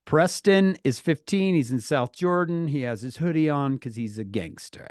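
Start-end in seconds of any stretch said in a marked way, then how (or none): none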